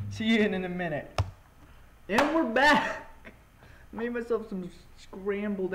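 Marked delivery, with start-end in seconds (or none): 2.1-3.1 excited. Real, not acted. Back from break, greeting viewers.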